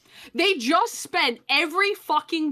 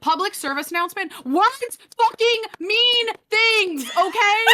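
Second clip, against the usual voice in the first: exasperated rant; 9 semitones higher, 5 dB louder than usual. Real, not acted. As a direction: hammer each word in disbelief. Ranting PSA, shouting.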